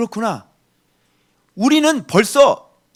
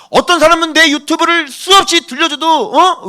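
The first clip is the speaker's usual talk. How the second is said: raised preaching voice; 7 semitones higher than usual. Real, not acted. Preacher worked up over people who hear his YouTube sermons, raised voice. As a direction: let the voice rise into near-shouting. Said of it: "또 소리를 지르고 있냐".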